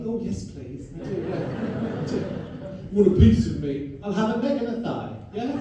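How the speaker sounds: camp eager reply, loud on the offer; a hammed-up come-on; flirty eager. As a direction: flirt back with relish. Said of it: "I'm always like".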